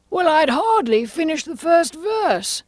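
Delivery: high, slow, loud Hatter voice; Mad Hatter. Acted. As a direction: tell it high and drawn out as the Hatter. Hatter recounting his disgrace at concert.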